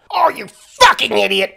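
loud, high American outburst; furious yell. Acted. Raving man yelling at Hamish, furious. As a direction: yell the insult in exasperation. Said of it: "the man yelled".

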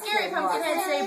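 ordinary delivery

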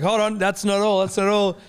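high, loud showman dad; dad's big build-up. Retold or imitated. Imitates dad's gift sales pitch.

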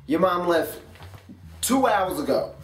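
ordinary delivery

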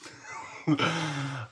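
short amused laugh; brief chuckle to yourself. Real, not acted. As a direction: let out a little laugh. Laughs after talk of price.

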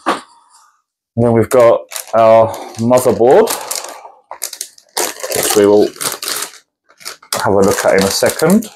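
crunching while talking; talking over crunching; crunching noises. Real, not acted. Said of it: "Sorry for the crunching noises".

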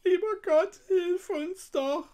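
high, put-on wailing girl's voice; pleading cry. A narrator's reading. Weeping Gretel begging God for help, crying out. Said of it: "rief sie aus".

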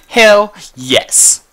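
loud high victory shout; triumphant cheer. Real, not acted. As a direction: celebrate the kill. Kills zombie with pickaxe.

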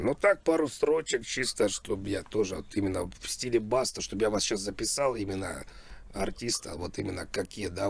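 ordinary talk

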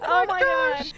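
high, loud shock with laughter; shocked exclamation. Real, not acted. Player reacts to the dog getting run over, shocked laughing exclamation.

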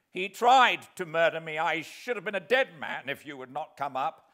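loud, high fearful plea; horrified. Acted. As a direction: cry out in terror of him. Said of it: "in extreme horror of his companion".

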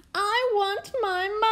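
put-on high, hooting child's wail. A narrator's reading. Princess left with the admiral, hooting for mum. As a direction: hoot it out with a long last word. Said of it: "hooted the little princess".

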